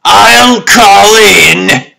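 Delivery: slow, brooding villain's deliberation; a ruler vowing revenge, each word weighed; slow, grave imperious lord; menacing lord. Acted. Lord Divine broods and plots.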